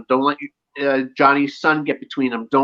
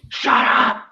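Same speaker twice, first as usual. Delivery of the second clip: raised pitch, mock-angry retort; retold angry snap; 8 semitones higher than usual. Retelling Miguel getting mad at Johnny, mock angry. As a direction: act out Miguel losing his temper. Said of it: "when Miguel got mad at him".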